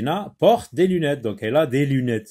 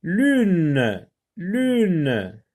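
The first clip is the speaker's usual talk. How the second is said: "Lune" stretched on a long falling tune; drawn-out model word; 2 semitones higher than usual. Shown as a demonstration. Teacher checking for the sound L, drawn-out word.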